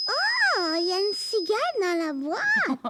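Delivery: high gasp of wonder, loud; wide-eyed "oh!" swooping up high; amazed discovery. Acted. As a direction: exclaim in astonishment at what's inside. Child discovers a cicada in box.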